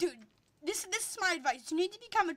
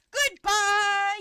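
loud, drawn-out send-off; taunting call; 2 semitones higher, 7 dB louder than usual. Real, not acted. Player waving off tryhard opponents, loud taunting call.

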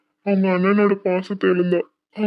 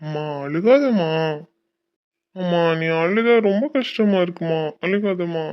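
anguished pleading; 3 semitones higher than usual. Acted. Son pleading with his crying mother, increasingly anguished. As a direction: implore her, unable to bear her tears.

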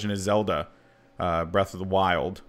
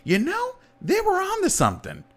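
much higher, sly musing voice; mock Nintendo exec. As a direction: muse like a scheming executive. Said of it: "someone from Nintendo looked at the Game Gear and was like".

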